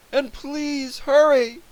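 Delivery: very high, drawn-out cry; pleading yell. A narrator's reading. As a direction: beg them to hurry, stretching the words. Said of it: "I yelled".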